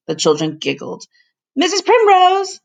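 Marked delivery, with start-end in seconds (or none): 1.5-2.6 high loud call of Missus Primrose; yelled name. A narrator's reading. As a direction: shout for her across the house. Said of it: "he yelled".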